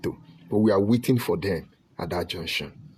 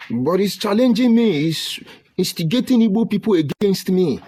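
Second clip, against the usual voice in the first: outraged; 9 semitones higher, 3 dB louder than usual. Real, not acted. Outraged complaint about elder's attacks.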